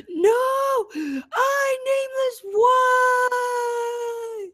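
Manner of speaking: high crying shout, hammed-up anguish; mock despairing cry, high and drawn out; melodramatic wail. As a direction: wail it like a soap opera tragedy. Mock anguish at religion control.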